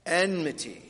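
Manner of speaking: enmity said as EN-mity, n before m; model pronunciation. Shown as a demonstration. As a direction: lead kids saying enmity, clear EN first. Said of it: "let's try that".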